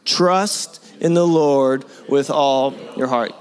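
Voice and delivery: slow, leading the congregation; call-and-response scripture line; slow unison recitation. Shown as a demonstration. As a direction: say it slowly with everyone. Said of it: "Can I get the men".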